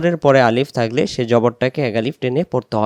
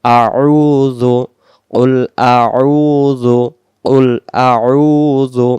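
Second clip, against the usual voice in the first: very slow, louder model recitation; Quran recitation; 6 dB louder, much slower than usual. Shown as a demonstration. Teacher modelling the phrase for learners, slow recitation. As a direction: hold the long vowel in আউযু.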